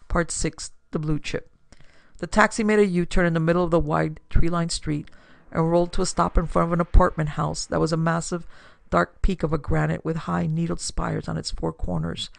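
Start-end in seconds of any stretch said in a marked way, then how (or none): none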